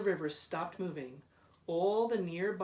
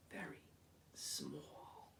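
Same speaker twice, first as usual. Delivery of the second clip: much softer, whispered away; 17 dB quieter, slower than usual. A narrator's reading. Lighthouse dwarfed by the bridge, whispered. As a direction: let it shrink to a whisper.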